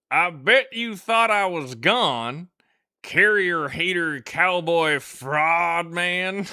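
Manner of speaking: loud, high, slow Alabama drawl; Alabama accent. Acted. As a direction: ham up a drawn-out Southern Alabama accent. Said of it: "I read it in that accent".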